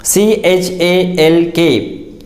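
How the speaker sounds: usual speaking voice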